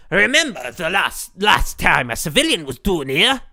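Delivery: put-on loud gruff Scottish accent. Streamer voicing a Scottish character, loud Scottish accent. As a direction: bark it like a Scottish guard. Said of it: "Yes, Scottish".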